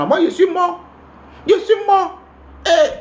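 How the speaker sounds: high, mock-panicked wail. Imagining being found out, high mock panic. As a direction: cry it out high like doomed. Fearing exposure.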